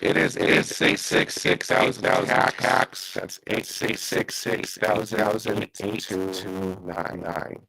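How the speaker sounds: glitchy robotic mic sound; breaking up over a bad line; robotic bad connection. Real, not acted. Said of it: "you sound like a, um, a robotic a little bit".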